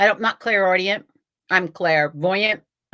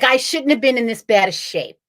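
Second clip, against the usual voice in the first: ordinary talk, nothing marked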